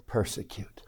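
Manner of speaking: hushed breathy whisper of the word; whispered persecute. Acted. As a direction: drop to a secret whisper. Preacher whispers key word.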